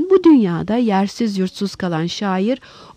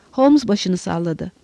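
ordinary delivery

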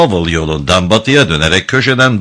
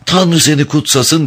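high-pitched voice of a thankful woman; grateful woman; 9 semitones higher than usual. A narrator's reading. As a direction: bless him in a higher female voice. Prostitute thanks Hunter in story. (minnettar kadın sesiyle)

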